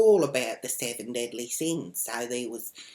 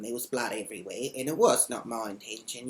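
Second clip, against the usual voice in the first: ordinary delivery, nothing marked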